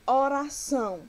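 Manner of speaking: careful teacher's model of "oração"; syllable demonstration. Shown as a demonstration. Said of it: "pronunciamos com mais força".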